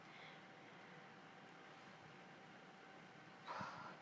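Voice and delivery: long breathy sigh, almost silent; heavy sigh. Acted. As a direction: let out a long, weary sigh. Sigh after the beating.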